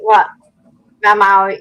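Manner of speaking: normal talking voice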